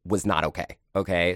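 normal talking voice

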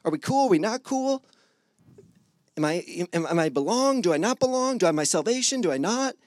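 lightly mocked nervous doubter; anxious believer. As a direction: worry aloud like an unsure believer. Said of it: "We're all over, like".

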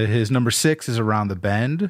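usual speaking voice

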